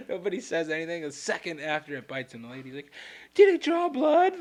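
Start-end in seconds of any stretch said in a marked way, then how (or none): 3.3-4.4 high, slow, mock worried question. The lady checking on Pat, mock worried and high. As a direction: fret over the bite, slowly and high. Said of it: "getting all, like, worried".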